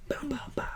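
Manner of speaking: breathy whispered zaps; whispered light zap. Pretend. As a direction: whisper the laser sounds. Light show sound effect.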